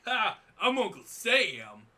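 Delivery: loud, high, slow cartoon Uncle Sam; hammed-up Uncle Sam bellow. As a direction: boom it out like a goofy patriot. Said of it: "Uncle Sam being all like".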